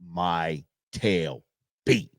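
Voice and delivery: low, slow, pitiful dog voice; cowering dog voice. Retold or imitated. Dog crawling to its owner, cowering slow low voice. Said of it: "Belly crawls, like".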